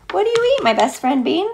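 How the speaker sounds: usual speaking voice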